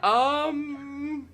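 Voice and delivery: high, loud nervous um; sheepish prisoner. Retold or imitated. Prisoner with holey boots, sheepish and high. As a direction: caught out, squeak it. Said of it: "the holes in his boots goes".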